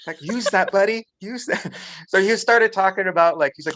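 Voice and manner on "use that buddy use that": high, loud teasing push; egging on. Retold or imitated. Speaker urging coworker to use his accent, high playful egging. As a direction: cheer him on like a buddy.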